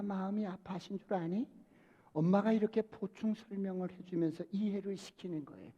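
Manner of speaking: lightly voiced tender mother; gentle mother explaining. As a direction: reassure the child quietly.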